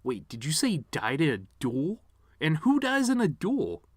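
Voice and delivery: rising to a high, loud disbelief; can't believe what he just heard; incredulous. Retold or imitated. Grieving son asking about a duel, rising incredulous. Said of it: "Questions like".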